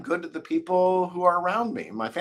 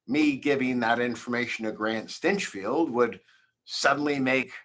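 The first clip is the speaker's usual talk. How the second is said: ordinary talk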